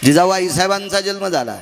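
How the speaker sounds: slow, ringing sermon proclamation; solemn kirtan announcement, slow. Acted. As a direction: announce it slowly to the whole crowd. Proclaiming Vivekananda's birthday.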